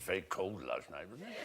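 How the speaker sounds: put-on low, very soft, slurred old statesman; Churchill. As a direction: mumble it low like an elderly leader. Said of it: "Churchill said".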